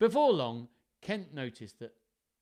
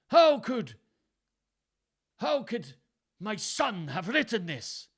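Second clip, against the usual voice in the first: high, loud, halting Welsh disbelief; shocked Welsh; 10 semitones higher, 10 dB louder, slower than usual. A narrator's reading. Shocked father doubts his son wrote it, halting Welsh accent. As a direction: falter in shock, still in stage Welsh. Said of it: "he mumbled".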